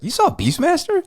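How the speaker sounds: genuinely taken aback; mock astonished actress; surprised actress. Actress voiced in disbelief.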